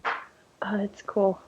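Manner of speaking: soft unvoiced whisper. Real, not acted. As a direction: breathe it in a whisper. Reacts in a whisper.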